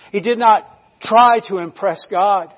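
ordinary delivery

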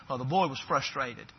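ordinary talk

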